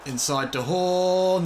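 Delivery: slow, high, loud stretched call; excited call. Real, not acted. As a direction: draw it out as the chance builds. Goal chance building.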